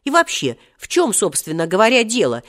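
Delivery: exasperated. A narrator's reading. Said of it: "вконец рассердился Серёжа". (рассерженно, возмущённо)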